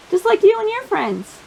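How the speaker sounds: ordinary delivery, nothing marked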